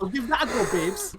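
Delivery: high, breezy mock voice; carefree Doctor impression. Friend echoing the Doctor's line, high gleeful mock voice. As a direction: echo it back, gleeful and high. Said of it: "who sees a ladder ... and goes".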